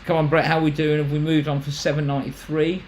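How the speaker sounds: lively auctioneer calling for bids. Acted. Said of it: "sounds like you're bidding auctioneer".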